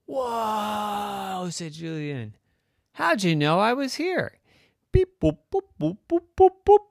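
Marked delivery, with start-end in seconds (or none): 0.0-1.5 long, high drawn-out wow; amazed whoa. Acted. Boy amazed by a robot. 2.9-4.4 put-on high, loud child's question; astonished question. Boy astonished at robot finding him. 4.9-6.8 high, loud staccato electronic beeps; robot beeping. Acted. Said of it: "a robot".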